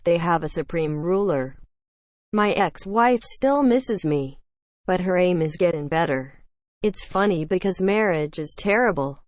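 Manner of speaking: usual speaking voice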